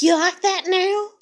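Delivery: loud, high-pitched angry taunt; mock aggressive taunt. Raging at people who preach at others, mock taunting. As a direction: throw it out as a pretend threat. Taunting imagined victim while venting rage.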